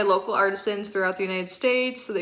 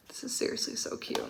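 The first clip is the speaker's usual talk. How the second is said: whisper; 12 dB quieter than usual. Real, not acted. Whispering over a cute item.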